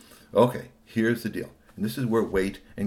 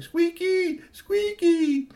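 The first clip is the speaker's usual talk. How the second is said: very high squeaky calls; calling Squeaky; 4 dB louder, much slower than usual. Acted. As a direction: call his name, high and pleading. Kids calling name.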